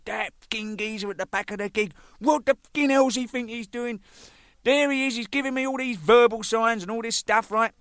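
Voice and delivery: high-pitched indignant complaint; outraged recounting; retold angry rant. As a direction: rant in disbelief, acting it out. Angry dressing-room complaint, retold.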